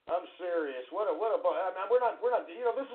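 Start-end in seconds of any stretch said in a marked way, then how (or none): none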